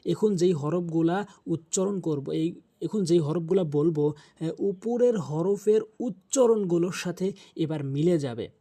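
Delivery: usual speaking voice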